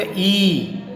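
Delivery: ई stretched out slowly; long drawn vowel. Shown as a demonstration. Teacher explaining the big vowel sign, ई drawn out. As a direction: hold ई for a long beat. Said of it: "इसको थोड़ा खींचेंगे".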